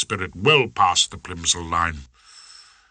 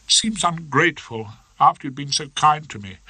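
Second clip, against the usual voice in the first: higher, fast upset voice; lightly voiced Miss Trant, distressed; young barrister giving up, upset; doleful young barrister; 6 semitones higher than usual.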